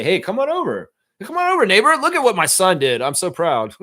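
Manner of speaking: high, eager neighbor showing off the damage; proud neighbor. Retold or imitated. Neighbor invites Trevor over to see the wreckage, high excited mock-proud voice. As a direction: brag like a delighted dad, pitch soaring up. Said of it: "His neighbor just wanted to say".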